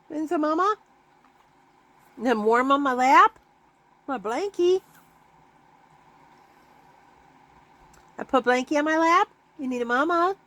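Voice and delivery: high cooing pet talk. Real, not acted. Owner cooing to the cat on her lap.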